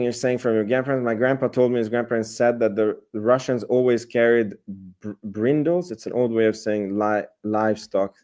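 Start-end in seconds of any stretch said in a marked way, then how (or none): none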